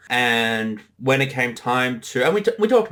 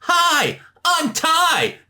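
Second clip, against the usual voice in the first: squeaky high cartoon greeting, pitched way up; high character voice; much slower than usual. Player at the table greeting everyone in a squeaky high voice. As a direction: chirp it high like a cartoon kid. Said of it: "and he goes".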